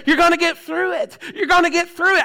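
normal talking voice

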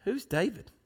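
soft, offhand put-down; rude, dismissive. Acted. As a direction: toss it off quietly with scorn. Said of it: "always carried a chip on his shoulder".

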